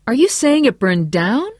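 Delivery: high, loud, disbelieving question; incredulous. A narrator's reading. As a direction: push the question upward in disbelief. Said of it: "Chuck's voice was incredulous".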